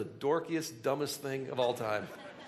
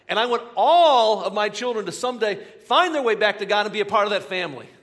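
louder, higher, urgent sermon voice; full-force preaching; 8 semitones higher, 9 dB louder than usual. Acted. Pastor pressing the congregation on family, preaching loud and high. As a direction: preach it out with rising fire.

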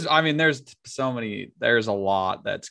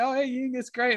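mock casual reply; 14 semitones higher than usual. Tim's retold reply.

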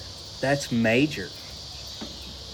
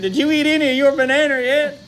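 higher, louder urgent voice; urgent question; 11 semitones higher, 4 dB louder, faster than usual. Retold or imitated. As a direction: ask it in a panic. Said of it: "He said".

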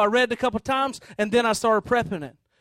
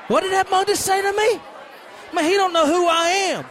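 offended, higher and raised; indignant; 8 semitones higher than usual. Acted. Blind beggar offended at the man who hushed him, indignant. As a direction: bristle at being told off.

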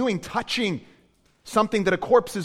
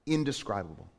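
soft echo; 8 semitones lower, 7 dB quieter than usual. Acted. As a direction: drop to a hushed repeat. Echoing the word softly.

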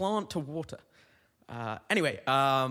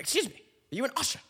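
high, fast British lady voice; hammed-up British woman, pitched way up; 12 semitones higher, much faster than usual. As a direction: pipe up quickly as a British lady. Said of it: "she's British in this story".

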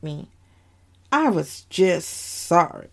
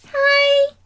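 put-on very high, loud little child's hi; toddler voice; 5 dB louder than usual. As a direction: chirp hello like a two-year-old. Two-year-old boy greeting.

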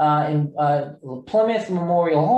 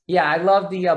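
normal talking voice

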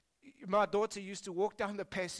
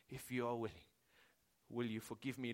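quiet prayer; 8 semitones lower, 8 dB quieter than usual. Retold or imitated. Worship team praying to the Lord, hushed. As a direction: pray it low and humble. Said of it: "this leper".

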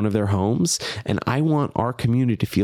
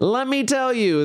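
high teasing sing-song; 13 semitones higher than usual. Acted. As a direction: let me tell you, sung up high. Repeated with big pitch jump.

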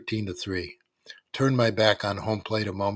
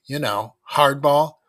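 spat out; 5 semitones higher, 7 dB louder, slower than usual. A narrator's reading. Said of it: "spat out Mick".

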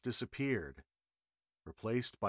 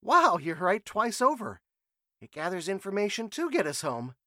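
high, loud, barely held-back thrill; bursting but trying to stay cool; contained excitement; 10 semitones higher, 9 dB louder than usual. A narrator's reading. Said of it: "barely containing his excitement".

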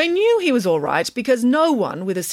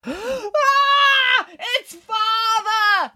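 long, high-pitched shriek; children yelling; much slower than usual. A narrator's reading. Three children seeing Father unmasked, horrified yell. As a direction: draw the yell out slow and very high. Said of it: "yelled all three children".

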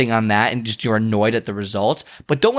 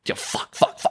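voiceless angry cursing under the breath; mouthed curses; 4 dB quieter than usual. Retold or imitated. Runner cursing his tight hip under his breath, mouthed curses. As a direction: barely breathe out the swearing, furious. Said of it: "under my breath".

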